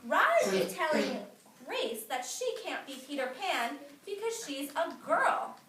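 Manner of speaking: ordinary talk, nothing marked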